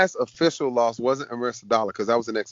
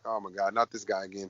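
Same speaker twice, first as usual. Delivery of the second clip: much softer, low retold groan of dread; dismayed aside; 4 semitones lower, 12 dB quieter than usual. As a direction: drop to a hushed, dismayed aside. Recalls his dismay at opponent's corner.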